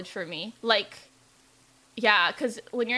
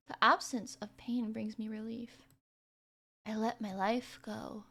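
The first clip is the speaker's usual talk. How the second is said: soft, subdued dying narration; story narration; 6 dB quieter than usual. Acted. Dying heroine narrates letting go, softly and slowly. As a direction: let the words fade away quietly.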